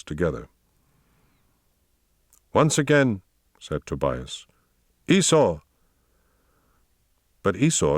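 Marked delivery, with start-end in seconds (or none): 2.5-3.2 high-pitched order to repeat; commanding master, pitched up; insistent command. A narrator's reading. Master orders tired dog to repeat. 5.1-5.6 high-pitched call to a dog; commanding call that falls at the end. A narrator's reading. Master commands his tired dog.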